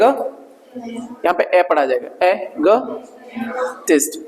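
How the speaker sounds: एगोटिस्ट drawn out very slowly and softly, short e at the start; slow, soft model of एगोटिस्ट; careful e sound. Shown as a demonstration. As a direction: stretch एगोटिस्ट out softly, opening on e. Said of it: "इसको एग".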